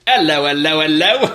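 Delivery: high, loud cockney "hello, hello"; hammy bobby-on-the-beat hello; London accent. Pretend.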